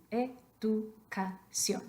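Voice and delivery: slowly separated syllables, e-du-ca-ción. Shown as a demonstration. Teacher splitting the word educación for students, syllable by syllable. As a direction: sound out "educación" one syllable at a time.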